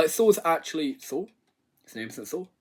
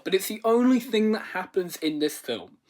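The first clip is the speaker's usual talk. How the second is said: ordinary talk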